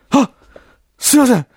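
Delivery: a caught-out manager stammering excuses; flustered manager. Retold or imitated. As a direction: fumble apologies to the angry boss.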